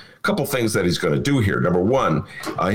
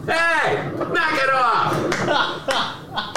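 yelling; 13 semitones higher, much slower than usual. Real, not acted. Yelling at a noisy neighbour.

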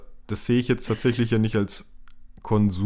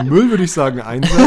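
laughing; 6 semitones higher, 9 dB louder than usual. Real, not acted. Laughing over trash amounts.

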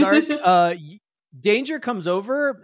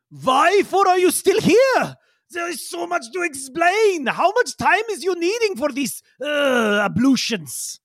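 high-pitched, exasperated pompous creature voice; Yigsel, impatient; 10 semitones higher, slower than usual. Acted. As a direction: complain grandly in a high, accented character voice. Game master voices Yigsel.

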